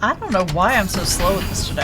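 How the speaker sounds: ordinary talk, nothing marked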